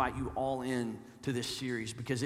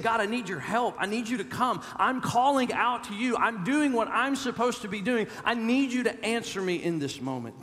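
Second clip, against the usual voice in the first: louder, higher, pressing plea to God; urgent pleading cry; 6 semitones higher, 4 dB louder than usual. Acted. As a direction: call out to God like you can't wait any longer. Voicing psalmist pleading with God.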